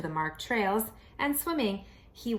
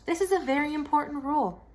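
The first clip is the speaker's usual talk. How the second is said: fast, higher fussy dad; lightly voiced dad; 6 semitones higher, faster than usual. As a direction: rattle off the rule like a fussy father. Dad stating house rule.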